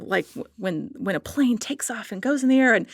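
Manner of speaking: ordinary talk, nothing marked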